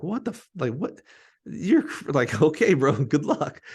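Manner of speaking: half-laughing mock disbelief; incredulous teammates. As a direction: imitate the stunned teammates, voice shooting up.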